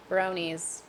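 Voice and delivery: ordinary talk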